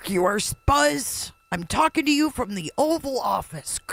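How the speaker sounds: high, crackly radio voice; like a scratchy old phone line; Nixon's call. Retold or imitated. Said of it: "Kinda like the crackle on Nixon's call".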